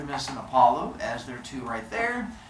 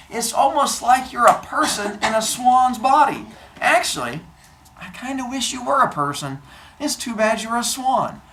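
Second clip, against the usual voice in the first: higher, gushing lovestruck voice; hammed-up confession to a pet; smitten girl; 7 semitones higher, 3 dB louder than usual. Leto confesses love to swan.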